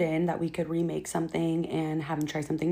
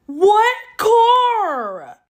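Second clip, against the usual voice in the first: high, loud incredulous question; mocking disbelief; 10 dB louder than usual. Real, not acted. To viewers, about the broke guy's car: loud, high disbelief. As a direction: scoff at the idea of a car.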